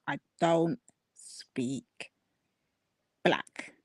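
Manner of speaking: very slow, word by word, with a long pause; pointed slow quote. Retold or imitated. Host quoting the guest's first insult, slow and pointed. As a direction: draw each word out, dropping low on the last.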